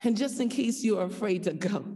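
ordinary talk

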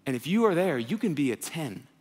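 normal talking voice

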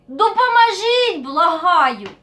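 loud, high cry for help; desperate plea. Acted. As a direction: shout as if stuck and begging. Stuck in cave, begging for help. (відчайдушний крик про допомогу)

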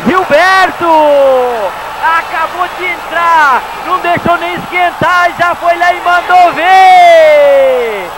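high, fast-paced hype call; excited commentator. Real, not acted. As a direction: keep the thrill going after the goal. Commentator praises the scorer.

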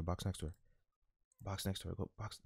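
fading urge. Real, not acted. Streamer urging his player, fading to almost nothing. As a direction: let the pleading die away.

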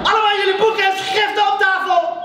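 loud, high-pitched classroom order; commanding teacher's shout. Acted. Teacher walking into a noisy classroom, commanding shout. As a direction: call the whole class to order. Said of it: "riep meester Arami".

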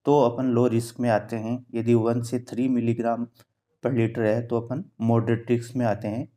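ordinary talk, nothing marked

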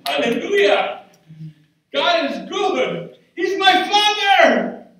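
high, loud ecstatic praise; rejoicing preacher, whooping; preaching at full force. Real, not acted. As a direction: shout the church into joy.

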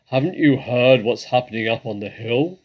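loud, agitated badger voice; excited, booming question. A narrator's reading. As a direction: burst out with urgent news, very loud. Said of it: "Badger said excitedly".

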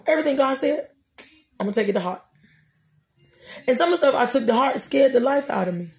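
ordinary delivery

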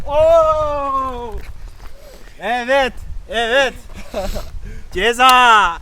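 loud, high gleeful cheer; excited cheering. Real, not acted. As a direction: cheer it out, gloating. Cheering loudly at the penalty. (sevinçle bağırarak)

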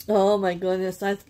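ordinary delivery

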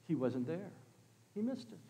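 very soft, confiding refrain; hushed aside. Real, not acted. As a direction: drop to a near whisper for effect.

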